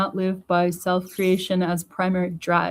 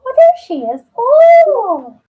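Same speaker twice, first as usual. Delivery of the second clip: loud, very high squeal of joy; loud, high greeting to her cat; delighted cooing; 12 dB louder, much slower than usual. Real, not acted.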